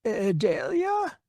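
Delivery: slow, hesitant murmured question; frail old man peering, unsure now. Acted. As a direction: squint and doubt. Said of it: "leaned in close to Lara's face and peered".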